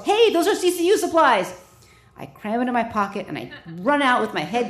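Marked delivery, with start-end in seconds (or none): 0.1-1.5 a sharp, loud, high yell from across the unit; nurse yelling. Retold or imitated. Nurse yelling at a sneaking intern, loud and high. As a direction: bark it out like a nurse catching a thief. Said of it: "just as the nurse yells".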